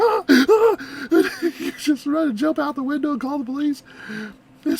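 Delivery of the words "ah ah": high, loud gasping shrieks; mock frightened scream. Friend in a robe standing over you, mock scream. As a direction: shriek in pretend terror. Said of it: "and you're like".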